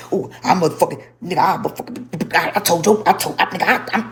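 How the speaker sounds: sputtering, stammering imitation of his bluster; mock tough talk. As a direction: trip over mock-tough cursing, never finishing. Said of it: "he gives that. He'll be like".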